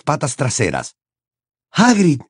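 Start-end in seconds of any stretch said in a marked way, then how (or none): 1.7-2.2 higher, panting call of a name; gasped shout after running; breathless shout. A narrator's reading. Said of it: "gritó jadeando".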